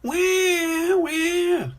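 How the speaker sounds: put-on high-pitched drawn-out toddler wail; loud, high mock bawling of a little one; crying wail. A narrator's reading. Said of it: "cries George".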